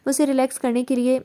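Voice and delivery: plain reading voice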